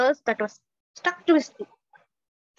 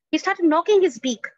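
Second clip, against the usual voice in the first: louder, higher, driving the words home; emphatic louder retelling; 5 semitones higher, 5 dB louder than usual. A narrator's reading. Storyteller repeats the knocking line, louder and emphatic. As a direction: hammer the line to show the struggle.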